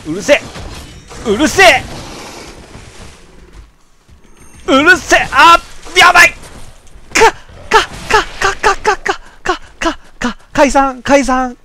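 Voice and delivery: losing it as the boss closes in; real frantic yelling. Real, not acted. Player panicking at the enemies, yelling high and loud. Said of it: "先ほどは取り乱しました".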